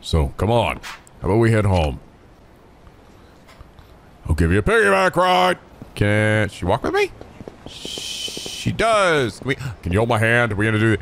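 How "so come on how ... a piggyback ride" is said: deep, gentle reassuring voice; Kiryu voice. Acted. Kiryu reassuring Ayako she is loved, deep and gentle. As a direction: comfort her like a caring uncle.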